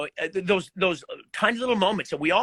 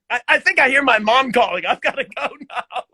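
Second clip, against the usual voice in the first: laughing; 6 semitones higher, 6 dB louder than usual. Retold or imitated. Escaping an embarrassing moment.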